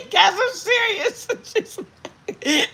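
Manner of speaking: squeaky high words through giggles, slow; laughing. Real, not acted. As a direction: insist while cracking up. Laughing while insisting.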